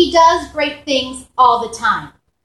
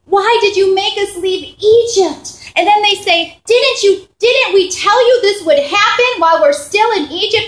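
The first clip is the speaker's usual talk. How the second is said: complaining; 7 semitones higher, 3 dB louder than usual. Retold or imitated. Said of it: "sounds like they're just so complaining".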